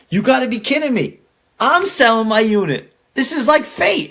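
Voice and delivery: high, excited disbelief; high-pitched "this is like fate"; amazed. Retold or imitated. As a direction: sound thrilled by the coincidence. Reaction to coincidence.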